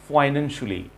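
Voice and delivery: "financially" with a short "shuli" ending; correct pronunciation. Shown as a demonstration. Teacher corrects learners after the wrong take, correct pronunciation. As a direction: say "financially" as "fainan-shuh-lee". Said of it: "It should be".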